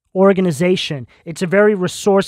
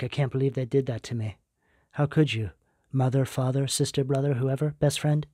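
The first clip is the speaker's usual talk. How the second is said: softer, lower hurt grumble; a hint of an aggrieved queen muttering; pissed off; 5 semitones lower, 10 dB quieter than usual. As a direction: voice the card figure's betrayed resentment under the breath. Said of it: "kind of pissed off".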